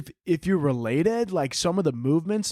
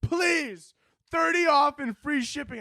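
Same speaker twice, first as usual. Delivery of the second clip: high, slow, pleading shout; desperate begging shout; 12 semitones higher, slower than usual. Retold or imitated. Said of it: "then they go".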